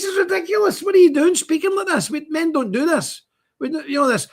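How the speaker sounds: high-pitched scolding, retold with exasperation; indignant telling-off. Driver grabbing his mate's phone, indignant. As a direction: scold him, voice right up high.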